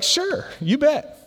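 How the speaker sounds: high, drawn-out agreement; eager Skin. Retold or imitated. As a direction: agree readily, pitched well up. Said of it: "if you know Skin, Skin's like".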